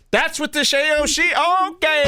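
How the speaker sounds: very high, squealed disbelief; mock astonishment. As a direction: blow up the shock into a falsetto shriek. Acts out own exaggerated reaction.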